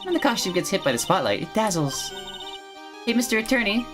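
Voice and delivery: put-on high, sweet girlish voice; bright innocent animal tamer girl; cheerful young girl voice.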